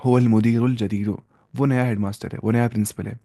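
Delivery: ordinary delivery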